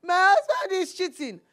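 high, loud mock cry; hammed-up crying wife on the phone; imitating a wailing caller. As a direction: wail it like a wronged wife phoning in. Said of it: "start calling me and start saying".

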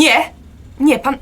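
loud, high no no; sharp refusal. Acted. Woman snapping at homeless man, loud refusal. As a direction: push the stranger away with your voice.

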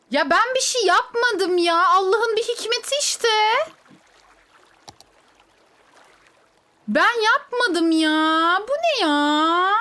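high, loud, slow wailing protest; exasperated protest. Real, not acted. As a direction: plead innocence to the laughing chat. Protesting to laughing chat. (yüksek sesle uzatarak isyan)

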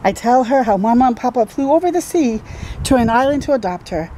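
ordinary delivery, nothing marked

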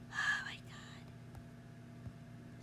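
very slow, drawn whispered despair. Real, not acted. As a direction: breathe it out without voice. Gamer frustrated by game.

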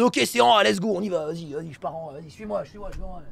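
quiet mock voice chat; imitating team callouts. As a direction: play the fooled players calling. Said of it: "Ils sont en mode".

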